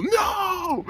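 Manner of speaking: very high, drawn-out mock cry; imitated yell. Fan imitates her yell as it opens, high yell. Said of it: "you just hear her yell".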